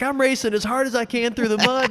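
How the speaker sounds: high, earnest; racer explaining. Retold or imitated. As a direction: say it high and eager. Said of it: "So he's like".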